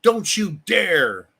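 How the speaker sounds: slow, drawn-out mock scold; sneering imitation of a scold. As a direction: wag a finger with the words. Mocking scolding opponents.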